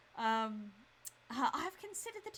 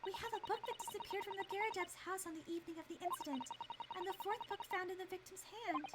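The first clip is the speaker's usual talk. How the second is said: higher, softer assistant voice, earnest; young female assistant; 8 semitones higher, 6 dB quieter than usual. Acted. Assistant urging the defense lawyer, higher and soft. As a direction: gently warn the lawyer what he must do.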